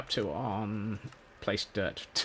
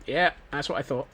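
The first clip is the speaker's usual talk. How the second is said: usual speaking voice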